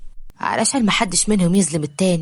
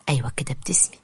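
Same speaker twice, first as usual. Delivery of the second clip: whispered, coaxing a smile; gentle whisper; 4 semitones lower, 6 dB quieter, faster than usual. Acted. Omar coaxing Roaya to smile, whispered. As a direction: softly urge her to smile.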